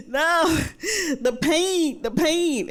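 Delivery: mildly anguished, pushing back that it hurts; distressed protest. Retold or imitated. Insisting to colleague about pain.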